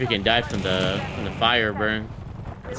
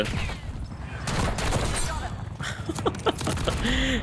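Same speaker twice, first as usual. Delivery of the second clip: airy high giggle; high breathy laugh; 7 dB quieter than usual. Real, not acted. Laughs while playing.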